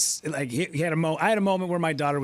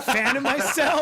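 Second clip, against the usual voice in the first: mock flustered; 9 semitones higher, 3 dB louder, slower than usual. Acting out emotion.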